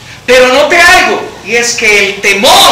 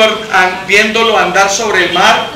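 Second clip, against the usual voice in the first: ordinary talk, nothing marked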